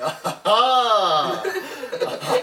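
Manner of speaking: high, blaring mock laugh; loud fake laugh. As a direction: imitate laughing obnoxiously in someone's face. Re-enacts laughing at strangers' faces.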